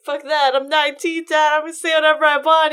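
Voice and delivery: put-on loud, high, fast teenage defiance; defiant shout. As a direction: fire it back at your father like a cocky nineteen-year-old. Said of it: "But Theon's like".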